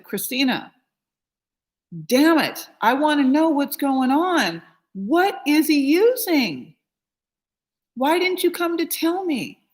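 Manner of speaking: loud, high, furious questions; mother yelling at her son's partner, angry and loud; angry confrontation. Retold or imitated. Confronting son's partner about drugs.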